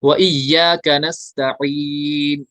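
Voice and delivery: व इय्याका नस्तईन drawn out slowly; slow recitation. Shown as a demonstration. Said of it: "या मद्दा है".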